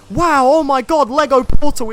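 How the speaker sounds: high, loud, amazed gasp; excited. Retold or imitated. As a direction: gush like an awed fan. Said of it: "people were so like".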